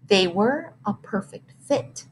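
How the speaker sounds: plain reading voice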